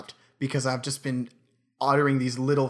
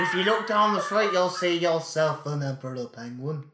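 British impersonation; 4 semitones higher than usual. Acted. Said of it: "If I like to impersonate British people".

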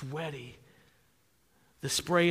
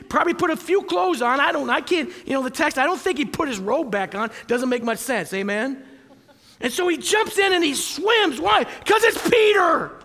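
loud, high, fired-up sermon; full-force preaching; 10 semitones higher, 10 dB louder than usual. Acted. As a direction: preach it out to the whole room.

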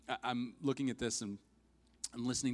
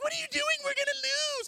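very high, loud squeal; mock frantic protest, pitched way up; hammed-up alarmed shriek; panicked teammates; 6 dB louder, faster than usual. Teammates panic as he shoots them.

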